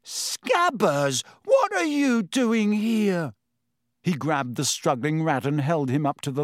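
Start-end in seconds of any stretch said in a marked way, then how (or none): 0.0-3.3 higher, drawn-out astonished question. A narrator's reading. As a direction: rise into slow disbelief at finding a lost pet. Ron finds his lost rat.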